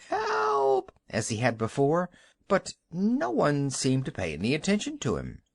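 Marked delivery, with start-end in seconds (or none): 0.1-0.9 very high yell for help. A narrator's reading. Husband yells for help as they leave. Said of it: "began yelling".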